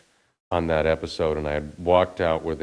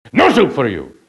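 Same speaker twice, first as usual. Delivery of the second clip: barked, louder and higher famous line; Soup Nazi catchphrase; 7 semitones higher, 8 dB louder than usual. Shown as a demonstration. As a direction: deliver the catchphrase like the stern soup man. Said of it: "Say the soup thing".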